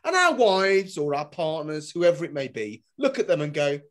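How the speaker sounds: usual speaking voice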